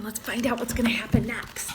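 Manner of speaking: hushed aside to listeners; whisper. Real, not acted. Reader whispering to the children about the leap, a hushed whisper. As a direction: whisper it in awe. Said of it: "reader's whispered aside".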